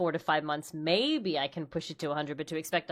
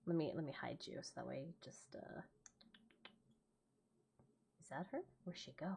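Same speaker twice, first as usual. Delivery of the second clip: whispered aside; 4 semitones lower, 20 dB quieter than usual. Real, not acted. Hiding the cohost's window, whispering to herself. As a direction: whisper it under your breath.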